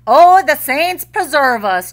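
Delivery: high, loud Irish whine; whining. A narrator's reading. Lazy Jamie whining to his wife, high and loud. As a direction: whine it like a sore lazy husband. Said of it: "Jamie would whine".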